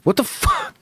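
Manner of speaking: shrieking way up high in mock disbelief; a squeaky, outraged what-the-fuck. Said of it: "and I was like".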